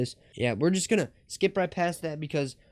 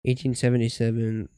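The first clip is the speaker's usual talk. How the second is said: normal talking voice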